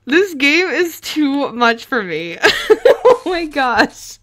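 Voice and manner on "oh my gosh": slow words through nervous laughter; breathless laughing gasp. Real, not acted. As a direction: laugh it off in disbelief. Laughing after shock.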